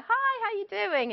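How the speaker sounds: cheery, high hello to a friend; bright greeting. Retold or imitated. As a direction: greet them like you're glad to see them. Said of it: "and you go".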